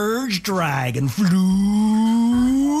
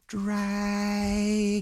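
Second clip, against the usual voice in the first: soft call; 4 semitones higher, 5 dB quieter than usual. Acted. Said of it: "Says a little louder".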